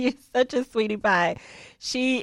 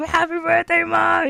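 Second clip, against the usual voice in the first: sons' greeting; 8 semitones higher than usual. Retold or imitated. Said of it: "the boys were like".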